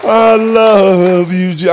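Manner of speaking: loving cry to Jesus, drawn out; crying praise. Retold or imitated. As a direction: let the words break into tears. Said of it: "I'm crying".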